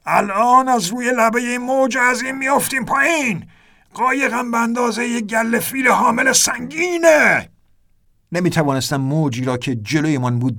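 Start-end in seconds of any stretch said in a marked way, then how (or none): 0.1-7.5 much higher shouted warning; alarmed shout. A narrator's reading. Ernest warning the narrator they will drop off the wave, shouting. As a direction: shout the danger over the roaring wave.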